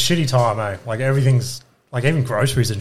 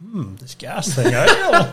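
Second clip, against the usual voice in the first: slow, doubtful mock dad musing; sceptical dad; 5 semitones higher, much slower than usual. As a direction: lightly voice a wary petrol-head dad. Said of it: "Dad ... he's like".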